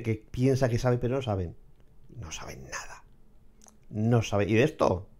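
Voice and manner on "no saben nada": soft unvoiced whisper, dropping the voice right down; whispered aside. Real, not acted. Vlogger mocking ignorant people, whispered aside. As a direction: hiss it under your breath like a secret jab. Muttered aside about know-it-alls.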